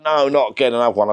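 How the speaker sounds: high exasperated complaint voiced; playing the fed-up wife. Wife's complaint retold.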